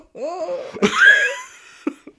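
real high-pitched laughter. Real, not acted. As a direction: laugh it off loudly. Laughing at his own offer.

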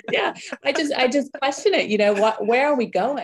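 high, loud, laughing protest; amused protest. Real, not acted. Guest defends herself after being teased, laughing. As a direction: defend yourself through real laughter.

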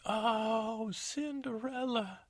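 high, slow drawn-out greeting; high slow sour greeting; crabby stepmother. Acted. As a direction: drag it out crabbily. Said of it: "she's so crabby".

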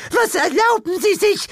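put-on very high indignant old lady; high shocked old woman; outraged old lady. A narrator's reading. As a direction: huff at the cheek of it.

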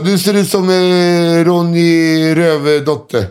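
put-on slurred drunk voice. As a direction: drag out the name like a drunk. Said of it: "han bara".

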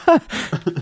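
high-pitched burst of laughter. Real, not acted. Laughs at own tweet.

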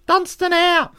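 very high squeaky sign-off; Dunstan's shrill voice. Retold or imitated. Dunstan signs off with his catchphrase, very high and squeaky. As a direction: chirp a cheery goodbye. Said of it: "that's what he'd often say".

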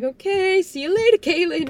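high, loud singsong farewell; mock breezy sign-off, pitched well up; awkward cheery goodbye. Imagined cameraman leaves crying Kaylin after filming, cheery goodbye.

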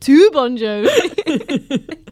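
cracking up mid-word; laughing too hard to finish. Real, not acted. Laughing at own joke.

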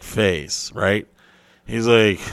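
ordinary delivery

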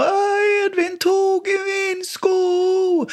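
put-on high, slow, wailing little-girl voice; whiny little girl. Daughter complaining to dad about her brother, high wailing child voice. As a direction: whine like a toddler robbed of her shoe.

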